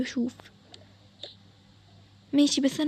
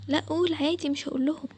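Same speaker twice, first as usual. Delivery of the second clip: usual speaking voice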